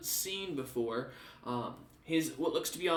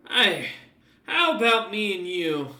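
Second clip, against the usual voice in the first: a louder, friendly coax with a slow ending; Alfred coaxing the dog; 4 semitones higher, 6 dB louder, slower than usual. Acted. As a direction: tempt the dog with a steak. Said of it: "and goes".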